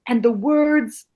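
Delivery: hammering the maxim home, drawn out; exasperated teacher. Retold or imitated. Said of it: "it's the same fucking thing".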